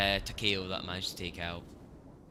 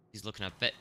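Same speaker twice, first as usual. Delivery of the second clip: ordinary talk, nothing marked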